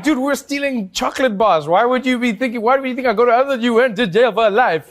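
high, loud mock exasperation; incredulous protest. Old friend's incredulous reply acted out.

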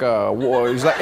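hint of a startled statesman's low hesitation; Barack Obama. President reacting to being bumped into, low and halting. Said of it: "the person I bumped into was Barack Obama".

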